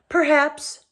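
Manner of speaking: icy retort; cold voice. A narrator's reading. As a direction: answer coldly, setting the word apart. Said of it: "in a cold voice".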